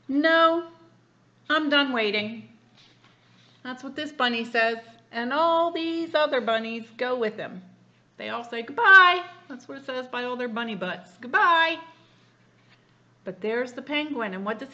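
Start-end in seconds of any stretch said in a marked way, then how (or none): 0.1-2.5 loud, higher huffy refusal; loud impatient little voice; impatient bunny. Acted. 8.6-9.2 loud, high chorus goodbye; big high loud farewell; shouted goodbye. Acted. 11.2-11.8 loud, high chorus goodbye; big high loud farewell; shouted goodbye. Acted. Bunnies goodbye repeated.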